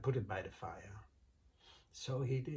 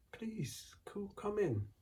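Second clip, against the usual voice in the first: high, sugary, innocent voice; sweet innocent wife; 10 semitones higher than usual. Acted. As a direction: play it sweet and harmless to fool him. Wife inviting giant in.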